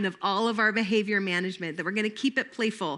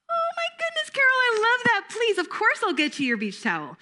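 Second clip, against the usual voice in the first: very high, exaggerated delight; overjoy at the polite question; gushing delight; 13 semitones higher than usual. Shown as a demonstration. Teacher delighted at request.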